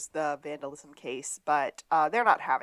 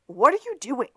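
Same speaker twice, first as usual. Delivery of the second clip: mock exasperated demand; 6 semitones higher, 8 dB louder than usual. Lassiter enters angry.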